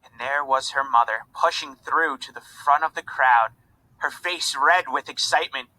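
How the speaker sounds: ordinary delivery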